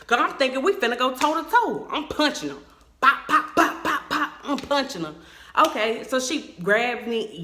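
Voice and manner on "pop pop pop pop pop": loud, high, staccato pops; imitated punch sounds. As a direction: fire off pops like landing punches. Imitates her repeated punches.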